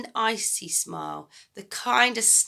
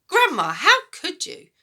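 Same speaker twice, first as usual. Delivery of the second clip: put-on high, loud boy's outcry; horrified boy; 11 semitones higher, 10 dB louder than usual. George horrified at eating beetles, loud cry. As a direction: protest in horror.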